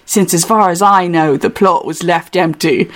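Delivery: put-on British accent, reading aloud as Hutch; lightly theatrical English accent. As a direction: read it in a put-on London accent. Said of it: "central London accent".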